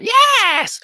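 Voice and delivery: high, loud squawked yes; the sweetheart parrot bursting in; high excited squawk. Acted. Sweetheart parrot answers eagerly.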